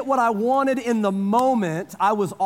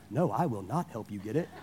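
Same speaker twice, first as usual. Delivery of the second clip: inner grumble; 13 semitones lower, 11 dB quieter than usual. Retold or imitated. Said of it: "I didn't say that out loud".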